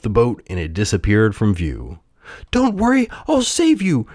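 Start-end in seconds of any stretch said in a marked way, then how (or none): 2.5-4.1 high puppet call, only mildly raised; brave shout. A narrator's reading. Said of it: "shouted the brave puppet".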